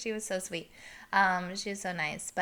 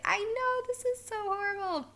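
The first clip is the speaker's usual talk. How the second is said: very high-pitched mock despair; squeaky, hammed-up self-reproach; mortified wail; 13 semitones higher than usual. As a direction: wail it in playful embarrassment. Acts out her mortified reply.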